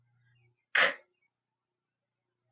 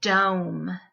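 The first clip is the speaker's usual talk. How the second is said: usual speaking voice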